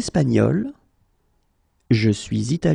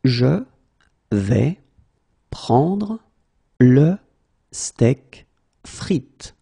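slow, word by word; much slower than usual. Shown as a demonstration. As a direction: pause after every word. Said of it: "teacher drills word by word".

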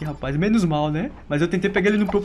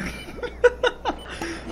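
sudden squeaky high giggle; 4 dB quieter than usual. Real, not acted. Gamer giggling at a stuck enemy, squeaky laugh.

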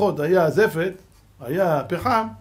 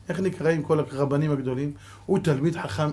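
ordinary talk

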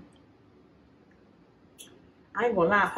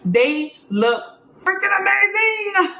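excited; 11 semitones higher, 5 dB louder, slower than usual. Real, not acted. Gushing over crabs.

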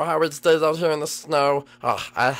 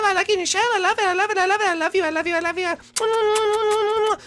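very high, loud, manic squeal; ecstatic Lemmy; 4 dB louder than usual. Acted. Lemmy greets his cousin in a high manic squeal. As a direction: bounce off the walls with giddy glee.

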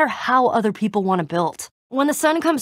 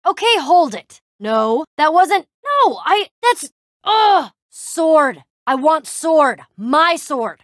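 flustered outburst; 5 semitones higher, 5 dB louder, slower than usual. Acted. Flustered outburst over losing sword.

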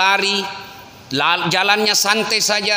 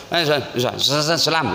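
stammered mock phone greeting; hurried broken salam; 6 semitones lower than usual. Men answering the phone, fumbled salam. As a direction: trip over the first syllable before getting the greeting out. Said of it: "nggak ada yang sempurna itu".

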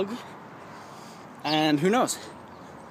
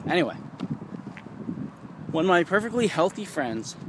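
normal talking voice

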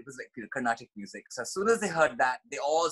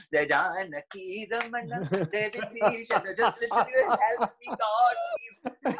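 desperate prayer; 7 semitones higher, 5 dB louder, slower than usual. Retold or imitated.

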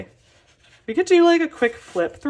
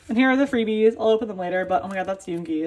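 ordinary talk, nothing marked

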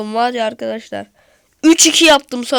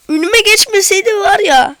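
higher and louder complaint; frustrated outburst; 5 semitones higher, 7 dB louder than usual. Real, not acted. Gamer complaining about being blocked, frustrated. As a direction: vent at the defender.

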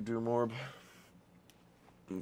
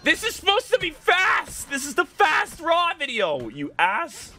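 very high, loud indignant rant; real exasperated yelling; furious yell; 6 dB louder than usual. Real, not acted. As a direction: shout at the game in outrage. Ranting at slow movement.

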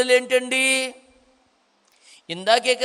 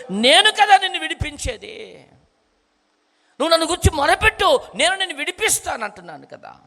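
lightly voiced God, higher and fast; 6 semitones higher than usual. Preacher voicing God promising rescue, lightly higher. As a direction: speak as God reassuring his child. Speaks as God in first person.

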